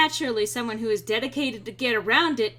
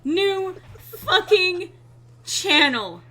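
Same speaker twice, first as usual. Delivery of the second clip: laughing exasperation; 8 semitones higher, 6 dB louder, much slower than usual. Real, not acted. Exasperated at backup channel.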